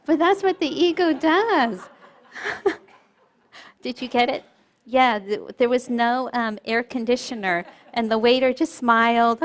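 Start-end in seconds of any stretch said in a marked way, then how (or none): none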